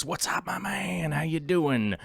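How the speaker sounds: playful stage whisper; hushed, airy hello; whispered greeting. Pretend. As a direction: drop into a whisper for one person. Hushed greeting to one chatter.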